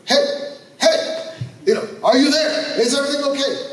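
high, loud frantic calls to the pilot; panicked calling. Retold or imitated. Preacher calling to his blacked-out pilot, panicked and loud. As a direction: shake the pilot awake in panic.

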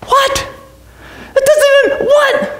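high-pitched incredulous sputter; hammed-up disbelief, breaking off; mock bafflement. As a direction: squeak it out, utterly baffled. Baffled by absurd accusation.

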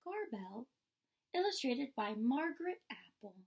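plain reading voice

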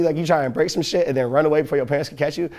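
ordinary delivery

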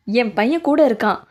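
normal talking voice

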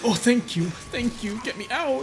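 high-pitched, urgent gratitude; frantic relief. Real, not acted. Player thanking a rescuer mid-surgery, frantic relief. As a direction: squeal thanks and beg to escape.

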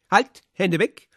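loud, high and slow halt; sharp command. A narrator's reading. Radek stops the old woman grabbing the notes, a loud sharp command. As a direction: bark her hands off the money.

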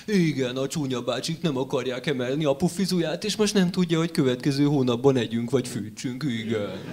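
put-on cooing baby-talk voice, pitch swinging up and down; mock gooey parent talking to an infant. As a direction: coo politics at a baby. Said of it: "gügyögni bármiről tudok".

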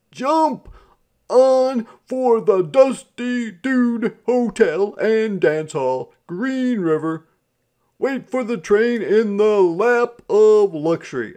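loud, high, drawn-out driver's call; hollering tout. A narrator's reading. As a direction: holler it to win over a crowd. Rival sleigh driver touting for riders.

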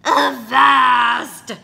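loud, high pirate bellow; captain bellowing at his son; pirate shout. Acted.

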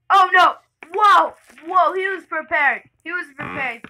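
real shock, blurting it out; yelling whoa in real surprise; startled yell. Real, not acted. Sudden surprise in game.

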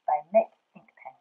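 plain reading voice

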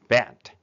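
バット run together as one short word; バット with no gap between B and "at"; fully blended word. Shown as a demonstration. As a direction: say バット as English "bat". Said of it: "ブレンディングすると".

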